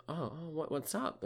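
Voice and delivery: high, soft puzzled question; lightly voiced bewildered friend; confused. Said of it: "really confused".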